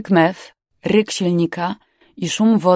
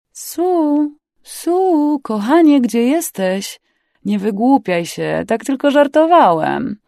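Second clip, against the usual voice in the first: drawn-out, higher coaxing call; 7 semitones higher, 6 dB louder, slower than usual. A narrator's reading. Pursuer calls to the hidden woman, coaxing call. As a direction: call coaxingly, stretching the words. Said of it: "nawoływał cicho".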